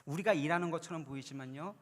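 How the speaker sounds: ordinary talk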